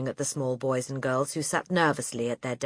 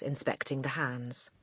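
usual speaking voice

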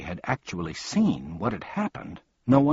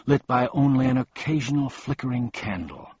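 usual speaking voice

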